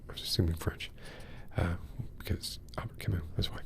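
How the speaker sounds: sheepish whispered aside; hushed self-correcting murmur. Real, not acted. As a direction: drop to a whisper, a little embarrassed at yourself. Embarrassed aside about Camus' nationality.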